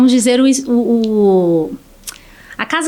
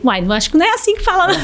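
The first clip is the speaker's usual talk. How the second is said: lightly voiced husband, fast, higher, a touch of teasing; tossing off a quick correction, a bit higher; husband correcting; 5 semitones higher, much faster than usual. She imitates her husband correcting her word, fast and light. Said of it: "meu marido".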